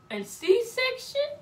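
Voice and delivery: slow high alarmed question; shocked. Retold or imitated. Patient hearing C-section, high shocked.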